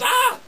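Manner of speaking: high, loud cry of dismay; frustrated yelp. Retold or imitated. Farmer crying out as the pig spills the milk, high yelp. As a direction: throw up your hands and wail.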